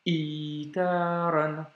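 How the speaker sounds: itaran, slow, a tiny catch before a long i; slow Arabic reading model; glottal stop demo. Shown as a demonstration. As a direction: block the breath, then stretch the i in itaran. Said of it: "un petit blocage juste avant et ensuite le i long".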